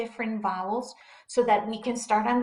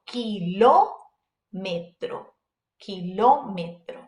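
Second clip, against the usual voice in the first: "kilómetro" with the LÓ punched louder and held; stress on the Ó; 2 semitones lower, 5 dB louder than usual. Shown as a demonstration. Teacher shows what the accent mark does, stress on the Ó. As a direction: lean hard on the second syllable of "kilómetro". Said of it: "put emphasis on it".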